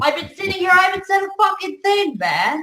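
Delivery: high-pitched furious complaint; angry rant. Real, not acted. Guest complains to the hosts, angry and high. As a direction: let the outrage spill out high.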